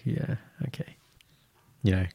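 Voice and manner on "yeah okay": breathy mock aside. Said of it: "and she was like".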